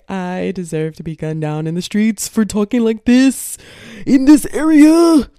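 loud, building to a high shout; mock outrage. Berating herself over her taco-truck accent, mock outraged shout.

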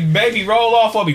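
ordinary talk, nothing marked

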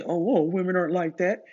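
lightly mocking voice of protesting men; a hint of a mock objector; objecting men. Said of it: "if you're not agreeing with what I say".

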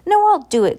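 high, loud cry. A narrator's reading. Lulu insisting she will do it, crying out. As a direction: cry out urgently over the others. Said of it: "cried Lulu".

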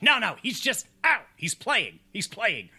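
high voice broken by an ow; imitating a bitten man covering up; mock pained reassurance. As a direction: insist it's fine through pretend pain.

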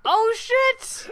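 high, excited "Oh, shit!" with a jump in pitch; surprised. Real, not acted. Host hears the Kenny connection, shouts high in surprise. Hearing the character was named Kenny.

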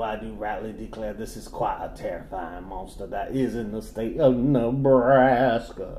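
genteel Southern gentleman voice; southern accent. Pretend. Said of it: "is my southern voice too classy".

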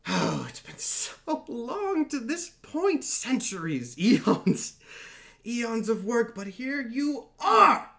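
high, slow theatrical villain voice; god of dreams. Acted. Dream gloating to the gathered heroes, slow and high. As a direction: savour every word like a gloating villain. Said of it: "Dream goes".

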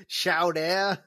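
"Chowder" said as show-DAIR; wrong pronunciation. Acted. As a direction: end "Chowder" on an open, rising -DAIR. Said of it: "say it right".

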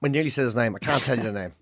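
low, soft aside to oneself; hushed aside. Real, not acted. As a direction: drop low and quiet, catching yourself. Aside after nearly saying name.

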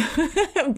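bright high laugh; quick amused laugh. Real, not acted. Laughs at own guilt.

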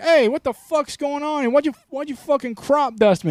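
high, loud indignant yelling; hammed-up furious customer; mock outrage. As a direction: shout it at the guy who farted on you. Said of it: "You're like".

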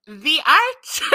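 rising, dramatic vampire announce; "The Archers" hammed in a vampire voice. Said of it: "why am I got into vampire voice?".

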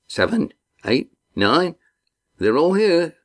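counting excitedly as the boats appear; Cam counting boats. Acted. Young Cam spotting the boats, rising excited count.

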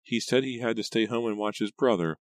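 high, plaintive cousin voice; thin, upset Pam. A narrator's reading. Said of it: "her voice thin and shaky".

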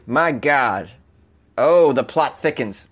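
ordinary delivery

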